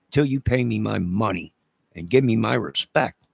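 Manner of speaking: ordinary talk, nothing marked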